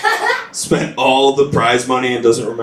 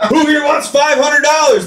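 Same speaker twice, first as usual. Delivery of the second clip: crowd-rousing shout; 11 semitones higher than usual. Retold or imitated. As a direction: rouse the crowd with the prize. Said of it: "A little quieter".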